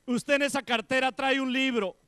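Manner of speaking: loud, high astonishment; woman amazed, telling the sister what she dreamed; amazed claim. Acted. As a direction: announce it in wonder. Woman recounts her dream.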